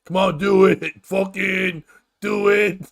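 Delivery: slow, drawn-out goading from onlookers; mock crowd egging someone on. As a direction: goad a friend, stretching out each "do it". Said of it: "onlookers laughed and egged him on".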